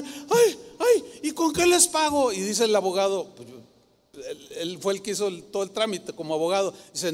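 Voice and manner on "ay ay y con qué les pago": high-pitched, overcome mock reaction; imitated astonishment. Woman hears her house is back, imitated high-pitched shock. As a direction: cry out as the stunned woman would.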